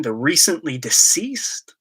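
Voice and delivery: a whispered aside. Pretend. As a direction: drop to an unvoiced, slow whisper. Joke about Iron Man's death.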